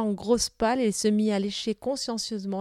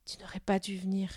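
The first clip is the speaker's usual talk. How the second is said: barely audible whisper; girl whispering a sad warning; 7 dB quieter than usual. Acted. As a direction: breathe it so only he hears. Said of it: "d'une voix si basse".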